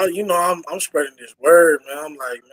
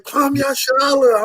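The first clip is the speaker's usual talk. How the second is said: called-out greeting; 8 semitones higher, slower than usual. Retold or imitated. Proud greeting called out at work.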